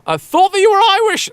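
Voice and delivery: put-on high, loud quote of an amazed girl; excited Irish girl. Admirer to performer after the show, high excited quote. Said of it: "an Irish girl came up to you".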